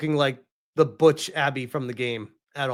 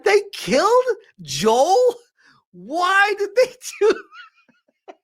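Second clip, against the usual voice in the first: much higher, slow, half-laughing wife voice; imitating upset wife; 4 dB louder than usual. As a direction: squeak out the wife's outrage through laughter. Voicing wife upset about Joel.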